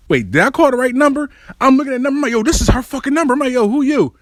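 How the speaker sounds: high, agitated disbelief; baffled caller. Retold or imitated. Caller checking the ex's number, baffled and indignant. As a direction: act out rising confusion at a wrong voice.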